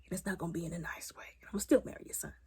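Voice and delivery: hushed menacing whisper; soft sly whisper; whispered threat. Retold or imitated.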